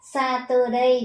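"Saturday" as sa-tuh-day with a hard t; common mispronunciation. Shown as a demonstration. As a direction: say each syllable of "Saturday" the learner's way. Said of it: "Các con hay đọc từ này là gì". (đọc sai "Saturday" thành "sa-tơ-đây")

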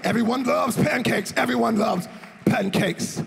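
loud mock quote. Repeating Mooney's line.

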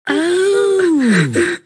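high wail into sobs; wail, then sob up high; crying. Acted. Friend hears Biden won't let them, high sobbing.

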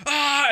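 high-pitched imitated war yell; high mock "ah" of a charging gunman; mock battle cry. Vlogger imitating Will charging in firing, mock battle cry. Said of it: "And then Will just goes".

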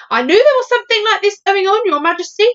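high, loud military man; blustering army chief. Acted. Head of army addresses Queen.

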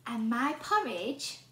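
usual speaking voice

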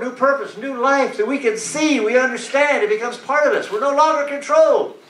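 full-voiced preacher driving each phrase home; preaching. Preacher urging the church to let God be God, preaching. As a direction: preach it out with long, punched key words.

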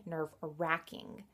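slow "nerve-wracking" with an a as in back; mom's RACK pronunciation. Retold or imitated. Vlogger puzzling over mom's word, slow "nerve-wracking" with an a. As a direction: draw out "nerve-wracking", middle rhyming with back. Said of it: "or she pronounced nerve-wrecking".

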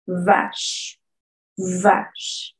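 vache with buzzing v and silent final e; vibrating v. Shown as a demonstration. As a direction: buzz the v in vache. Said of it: "tenemos que vibrar ahí". (vache con la v vibrando y sin la e final)

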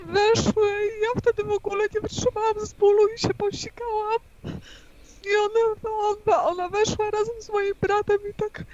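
tearful, voice breaking high; fighting back sobs as she speaks; crying. Real, not acted. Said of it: "Tak, tak, płacz". (płacz)

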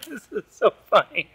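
real helpless laughter through the words; laughing. Real, not acted. Dad amused by child's magic beak, laughing. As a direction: talk while cracking up. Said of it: "Daddy's laughing".

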